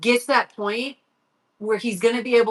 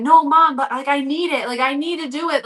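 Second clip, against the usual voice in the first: higher-pitched retold teenage plea; pleading; 5 semitones higher, 4 dB louder than usual. Son begs mom to keep training, pleading. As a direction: plead insistently, as if quoting your kid.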